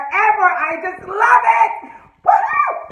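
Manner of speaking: very high, slow and loud squeal of joy; excited. Real, not acted. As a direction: gush like a thrilled fan. Fangirl excitement.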